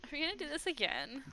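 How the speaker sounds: a hint of a weary valley girl; tired Valley Girl. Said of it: "The very tired Valley Girl".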